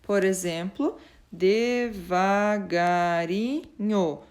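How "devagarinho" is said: "devagarinho" stretched as de, va, ga, ri, nho; five slow, drawn-out beats on "devagarinho"; split into syllables. Shown as a demonstration. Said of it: "Tem cinco sílabas".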